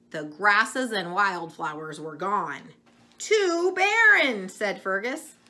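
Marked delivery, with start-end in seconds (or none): 3.2-4.5 much higher, louder, slow whine; upset calf. Acted. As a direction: drag it out like a sulky little calf.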